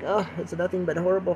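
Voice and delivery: ordinary talk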